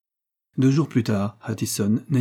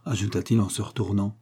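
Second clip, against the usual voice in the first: plain reading voice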